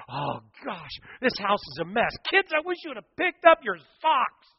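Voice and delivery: high, loud, frantic housewife griping; shrill-free high panicked scolding of the kids; frazzled Martha. Acted. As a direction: fuss like a harried host tidying for a guest. Martha voiced fussing about mess.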